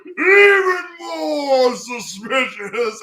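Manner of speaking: high, loud, very slow wailing cry; hammed-up Armstrong. Armstrong reacting to the guards' denial, loud slow mock wail. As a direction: ham it up like a weeping strongman. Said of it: "And then he's like".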